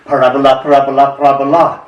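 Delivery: loud, excited chant of the ball; hollering. A narrator's reading. Poet voices the ball flying off the wall, hollering. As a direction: holler it out in three even bursts. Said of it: "hollers the ball".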